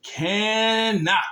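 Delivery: CANNOT stretched out and emphatic; strict emphasis. Shown as a demonstration. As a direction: draw out CANNOT, slow and deliberate. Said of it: "the strict emphasis of the word".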